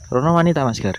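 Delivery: loud urgent call to a friend. Real, not acted. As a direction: shout to get his attention. Calling friend to look over there. (bengok-bengok nyeluk kanca)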